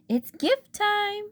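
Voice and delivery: high, slow party call; hollering. Acted. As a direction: holler it to the whole room. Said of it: "she hollered".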